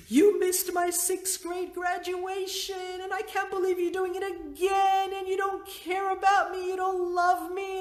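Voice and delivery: put-on high, wounded teenage daughter accusing her mom; hurt crying daughter. Crying daughter voiced in story.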